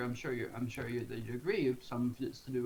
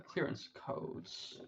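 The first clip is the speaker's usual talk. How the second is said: muttered whisper; 2 semitones higher, 7 dB quieter, much slower than usual. Real, not acted. Mutters to himself, panicked.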